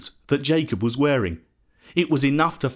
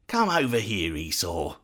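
low, softer beckoning with mild doubt; understated suspicion; a little suspicious; 6 semitones lower, 5 dB quieter than usual. A narrator's reading. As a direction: call him over warily, voice dropping. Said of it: "Isaac said a little suspiciously".

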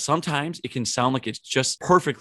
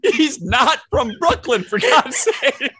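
high, loud protest through laughter; slow, laughing disbelief; cracking up; 13 semitones higher, 7 dB louder, much slower than usual. Real, not acted. Cracking up at the wrong accent.

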